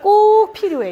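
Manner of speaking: 꼭 said high and firm, then 필요해; stress on 꼭. Shown as a demonstration. Teacher demonstrates the key word 꼭 for parents, stressed. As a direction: lean hard on the word 꼭. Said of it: "꼭을 굉장히 중요해요".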